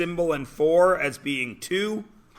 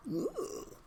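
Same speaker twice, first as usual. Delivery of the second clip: eerie ooh; 14 semitones higher, 16 dB quieter than usual. Retold or imitated. Body feeling eerie movie sound.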